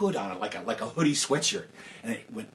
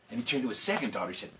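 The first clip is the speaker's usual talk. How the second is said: ordinary delivery, nothing marked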